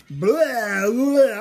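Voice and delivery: loud, high mock wail; imitated bawling. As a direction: blurt out a cartoon sob. I was just crying.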